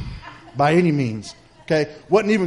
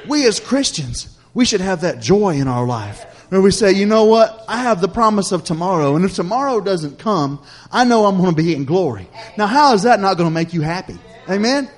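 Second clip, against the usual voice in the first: higher, driving sermon delivery; earnest preaching; 4 semitones higher, 4 dB louder than usual. Real, not acted. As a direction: preach it with rising conviction. Preaches the point home after story.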